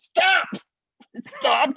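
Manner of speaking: high, loud retold yell; shouted command. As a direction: bark it like a sudden order. Prince shouting at kid.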